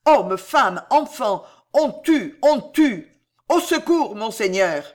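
desperate high-pitched shouting; roaring. A narrator's reading. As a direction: roar out the alarm and plead for help. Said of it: "rugit la Trémouille".

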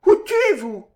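high, loud imperious order; commanding vestal. A narrator's reading. Eugénie ordering Andrea to kill himself, imperious. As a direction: command him like a vestal in the arena. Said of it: "du ton ... d'une de ces vestales".

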